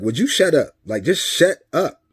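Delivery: loud, high, exasperated retort; fed-up outburst. Retold or imitated. Dad to ex who keeps repeating her worry, fed up. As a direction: act out losing patience, slowing down on the repeat. Acted argument, fed up with repetition.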